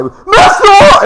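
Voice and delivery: very high, loud shriek with a cracking voice; voice breaking shout. Retold or imitated. Panicked student shrieking for his master, voice cracking. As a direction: yell like a teenager whose voice just broke. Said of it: "like he was sixteen ... voice changes".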